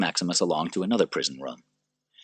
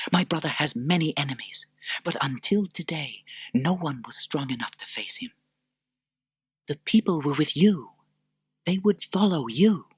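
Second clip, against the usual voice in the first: earnest Lucilla; 10 semitones higher than usual. Acted. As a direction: urge him gently.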